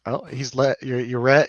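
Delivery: ordinary delivery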